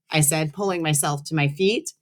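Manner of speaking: plain reading voice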